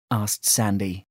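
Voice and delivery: usual speaking voice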